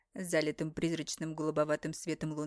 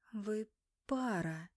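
slow, breathy amazed gasp; astonished breath; 3 semitones higher, much slower than usual. Acted. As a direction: breathe it out in awe. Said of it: "поражённо выдохнула я".

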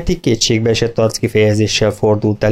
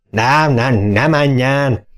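slow, drawn-out plea, lightly voiced farmer; farmer crying out; 2 semitones higher, much slower than usual. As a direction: stretch out a desperate don't go. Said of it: "Kiáltotta Mister Twenty".

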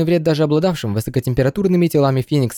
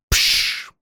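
hissing flame sound. Acted. Fire ignition hiss.

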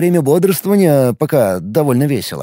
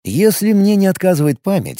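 plain reading voice

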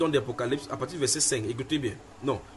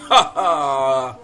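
short laugh; 3 semitones lower, 6 dB louder than usual. Real, not acted. Laughs at the congregation's plight.

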